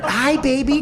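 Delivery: mock the friend's cooing at a dog; mocking baby talk. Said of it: "Do you understand?".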